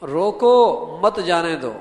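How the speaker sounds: روکو drawn out loud, then مت جانے دو; slow command: stop him with روکو, then forbid with مت جانے دو; loud stretched روکو, break before مت; pause after روکو. Shown as a demonstration. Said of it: "کتنا فرق ہو جائے گا".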